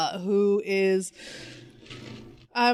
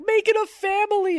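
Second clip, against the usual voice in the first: much higher, louder sarcastic quote; mock Batman; 11 semitones higher, 3 dB louder than usual. Mocking Batman's advice in a silly voice. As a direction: mimic him in a silly voice.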